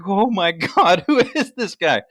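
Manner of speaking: high, loud stunned disbelief; mock astonished server; shocked waitress. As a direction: gasp it out like she can't believe him. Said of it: "she's like".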